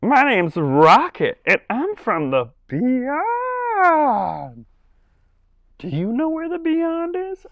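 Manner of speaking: put-on very high, slow childlike puppet voice; a tiny kid sounding out space-y words; slow squeaky child puppet voice; Rocket puppet voice. Shown as a demonstration. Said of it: "So he sounds a little".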